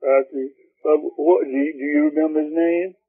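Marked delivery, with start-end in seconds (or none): none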